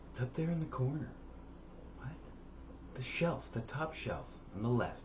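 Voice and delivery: a high, dreamy mock of a half-asleep voice; mock sleep talker. Teasing the partner about talking in her sleep, in a high mock voice.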